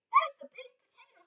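high, amazed wife voice. Acted. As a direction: marvel at the potato. Wife's quote.

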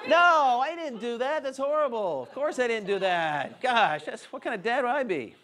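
high, loud-starting comic protest; mock indignation. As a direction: act playfully appalled at the very idea. Mock protest at his own joke.

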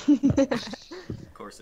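high, slow mocking sarcasm; high sarcastic voice. Mock knowing reply.

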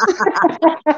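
"a decal" said high, breaking into a laugh; Southern "a decal" with a giggle. Real, not acted. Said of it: "Say, say decal again".

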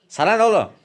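high, loud snap back; sert çıkışma. Acted. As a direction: bite back at the meddler. Kavgacı hasta doktora çıkışıyor. (tepkili, yüksek sesle çıkışma)

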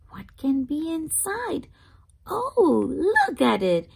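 usual speaking voice

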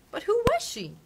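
put-on high, loud, urgent girl's question; Dorothy asks with eager alarm; little girl voice, bursting to know; little girl asking. A narrator's reading. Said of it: "asked Dorothy".

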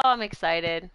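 normal talking voice

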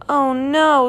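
high, drawn-out cry of dismay. A narrator's reading. Bert has stepped on his new sunglasses, dismayed.

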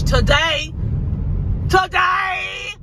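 high sudden shout of Today!; mock threat shout. Vlogger hams up a threat to her missing friend, shouting. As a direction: yell it like a threat.

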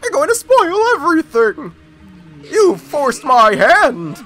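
a grand, threatening villain. Acted. Reads villain's lines aloud.

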